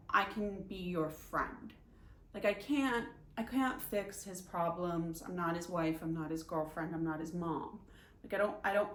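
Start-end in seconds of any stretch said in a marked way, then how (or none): none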